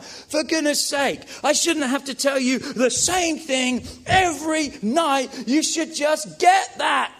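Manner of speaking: exasperated parent. Retold or imitated. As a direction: scold the kid who forgot again, fed up. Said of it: "here's where I respond".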